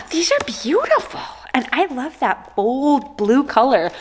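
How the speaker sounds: high, gasped admiration; awed delight. Real, not acted. Vlogger admires the cards, high gasping delight. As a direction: gasp it out, thrilled.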